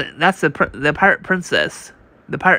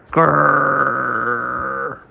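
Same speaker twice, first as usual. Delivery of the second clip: angry growl; 2 semitones lower than usual. Acted. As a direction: growl it out like a caged beast. Angry prisoner growls in comic.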